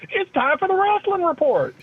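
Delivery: high, loud segment announcement; big hyped-up show intro; mock announcer. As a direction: belt it out like a ring announcer.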